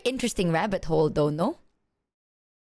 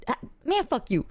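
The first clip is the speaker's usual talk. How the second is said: ordinary talk, nothing marked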